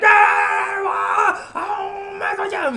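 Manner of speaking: put-on very high yelping old man; shrill mock fright from an old man; startled old man yelp. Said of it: "this old man's like".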